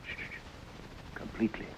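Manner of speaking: soft unvoiced shushing, then a whispered word; whispered shh to silence the room; hushing whisper. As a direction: hush everyone, barely breathing it. Holmes hushes the room.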